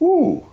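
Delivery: high-pitched "ooh"; stung "ooh". Retold or imitated. Salesman reacting to the client's rebuke, stung high "ooh".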